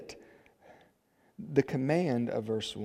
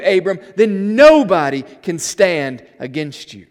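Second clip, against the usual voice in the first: very loud, high pulpit proclamation; raised voice, hammering each declaration; full-force preaching; 6 semitones higher, 14 dB louder than usual. Real, not acted. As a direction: thunder out God's promise to the congregation.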